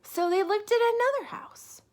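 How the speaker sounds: bright, loud read-aloud voice; storybook reading. A narrator's reading. Teacher reading a picture book to children, lively and loud. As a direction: read it big for the little ones.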